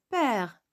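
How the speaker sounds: père with a wide-open è. Shown as a demonstration. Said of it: "C'est comme ça".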